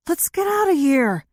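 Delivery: high cry in long stretched syllables; drawn-out cry. A narrator's reading. Thief calling to flee, in drawn-out syllables. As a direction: stretch each syllable like slow motion. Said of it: "he cries in long, drawn-out syllables".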